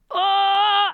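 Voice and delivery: loud, high, held "oh"; drawn-out loud high-pitched "oh"; inner-monologue wail. Retold or imitated. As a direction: let out a long high cry of inner panic. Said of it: "exactly what my internal monologue was like".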